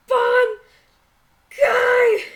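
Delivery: high, drawn-out anime battle scream; super intense voice. Retold or imitated. Co-host imitating her favorite anime hero, super intense shout. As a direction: belt it out like a shonen hero. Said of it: "super intense voice where he's like".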